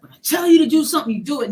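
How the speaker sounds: fast, high, loud barked command; angry order. Retold or imitated. Uncle yelling at his niece to obey, fast angry shout. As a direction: bark it at her, fast.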